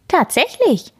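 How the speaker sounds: put-on young cub exclaims in wonder; excited child cry. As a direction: bear cub calling out amazed. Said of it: "rief Erdbeer".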